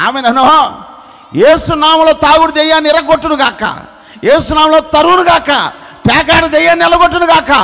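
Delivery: high, loud commanding rebuke of demons; shouted deliverance prayer. Acted. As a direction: drive the demons out at full voice. (పెద్ద గొంతుతో దెయ్యాలను గద్దించడం)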